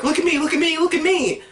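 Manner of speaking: a smaller hint of the attention-begging film; show-off movie. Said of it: "I wish that they didn't have to be like".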